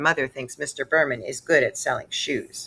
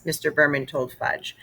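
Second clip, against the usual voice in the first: ordinary delivery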